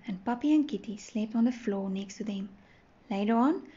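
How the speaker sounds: ordinary delivery, nothing marked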